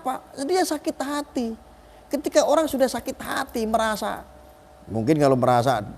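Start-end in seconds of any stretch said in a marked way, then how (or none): none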